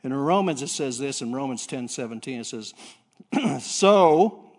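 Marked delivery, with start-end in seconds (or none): 3.6-4.5 "So" drawn out high and loud; stress on so. Shown as a demonstration. Preacher quoting Romans to the congregation, punching "So". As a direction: land hard on "So". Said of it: "that's a big word, isn't it?".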